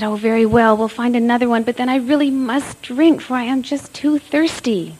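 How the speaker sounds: impatient agreement. A narrator's reading. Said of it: "So he said".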